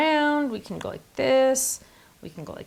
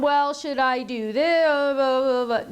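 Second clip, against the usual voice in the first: dithering; 2 semitones higher, 3 dB louder, much slower than usual. Pretend. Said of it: "I don't wanna spend time going".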